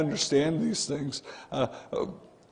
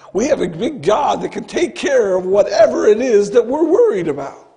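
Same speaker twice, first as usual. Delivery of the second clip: building louder and higher through the list; rising, louder preaching climax; preaching at full force; 5 semitones higher, 7 dB louder than usual. Acted. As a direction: drive it home like a preacher's climax.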